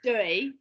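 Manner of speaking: "Dirty" with the T nearly gone; dropped T. Shown as a demonstration. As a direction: say "Dirty" almost as "doy-ee". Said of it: "the T is almost not there at all".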